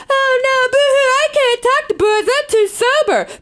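high, hammed-up whiny wail; mock the boo-hoo excuse; mock whining. Mocking whiny quote.

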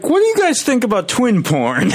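put-on high-pitched joking child impersonation; mock British kids. Co-host joking as the kids, mock British child voice. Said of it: "And they're like".

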